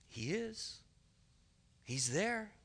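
very soft, knowing answer; hushed reminder. Real, not acted. Preacher telling the congregation Jesus is present, hushed reminder. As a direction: drop right down to a hush. Softly answering the guilty thought.

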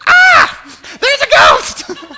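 very high, loud comic shriek; hammed-up terrified scream. Preacher acting out burly fishermen seeing a ghost, comically terrified. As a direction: scream in exaggerated terror, drawing it out. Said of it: "they cried out in fear".

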